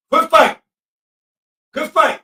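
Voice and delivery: loud encouraging yell; cheering shout. Real, not acted. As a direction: hype the defense up. Cheering the play live.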